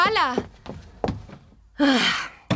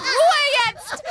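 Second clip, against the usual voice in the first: higher, sharp order; 6 semitones higher than usual. Acted. Bibi telling the crying twins to hush, sharp. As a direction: cut through the babies' crying.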